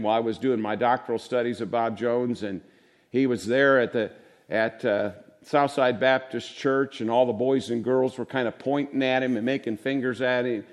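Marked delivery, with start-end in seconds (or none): none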